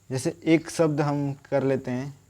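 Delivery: usual speaking voice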